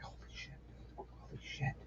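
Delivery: barely audible, voiceless whisper; awestruck whisper. Retold or imitated. Fan spotting his idol at lunch, awestruck whisper. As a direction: whisper it to yourself, starstruck. Awed at seeing a musician nearby.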